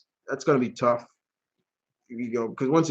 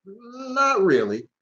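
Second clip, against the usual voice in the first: slow, higher-pitched "not really"; drawn-out skepticism; 8 semitones higher, much slower than usual. Retold or imitated. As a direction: stretch it out, doubtful. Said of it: "I'm like".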